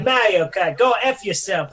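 brash New York wiseguy; New York accent. Pretend. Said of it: "your New York accent's coming out".

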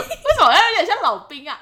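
high, loud laughing question. Real, not acted. Laughing at own voice. (边笑边说)

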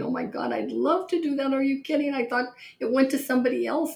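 imitating an overjoyed squeal; excited scream. Oprah answering Spielberg's offer, imitated high excited squeal. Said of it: "she starts screaming".

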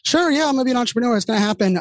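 mock eager agreement. To people pushing him to go solo, mock eager. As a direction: toss off a hollow yes to everyone's advice.

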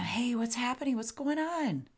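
concerned but steady check-in; concerned, not sad. Shown as a demonstration. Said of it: "your lilt in your voice can be not super sad".

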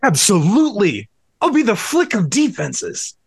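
pumped and unhinged; hyped up. Acted.

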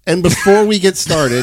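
laughing while speaking. Real, not acted. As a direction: say it through a laugh. Host laughing while starting show.

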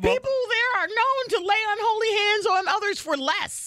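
high-pitched, emphatic declaiming; comic warning. Real, not acted. Comic warning about Philadelphia fans.

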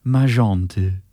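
saucy French letter opening; French accent. Acted. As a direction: give the name a French pronunciation. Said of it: "do your sauciest French accent".

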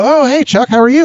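high, effusive mock greeting; cheery greeting. Vendor greeting Chuck at the show, cheery high imitation. Voicing someone's greeting in a story.

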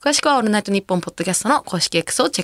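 brisk, even announcer's read; radio announcement read. Real, not acted. Host gives listeners the mail address and campaign, radio announcement read. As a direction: read out the show notice smoothly.

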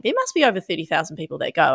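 normal talking voice

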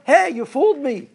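the buried seed screams up at the one who planted him; screaming up. Acted. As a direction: yell up from under the ground, stretched out. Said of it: "he screams up".

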